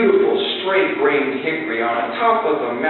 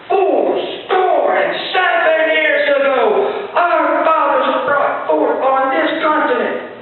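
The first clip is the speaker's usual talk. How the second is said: shouting the speech; 12 semitones higher, much slower than usual. Retold or imitated. Man reciting the Gettysburg Address up the mountain, shouting. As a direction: bellow it up the mountain to memorize. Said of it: "shouting to the tops of my lungs".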